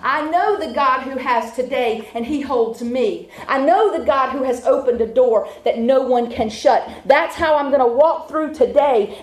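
ordinary delivery

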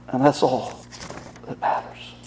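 low, soft, fading into a whisper; hushed repeat. Real, not acted. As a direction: let it drop away to a whisper, with pauses. Preacher softly repeats his father's words.

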